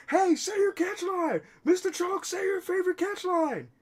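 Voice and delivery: high, pleading fan voice; high squeaky fan asking nicely; eager fan. Acted. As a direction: beg the star for his line. Fan begs for the catch line.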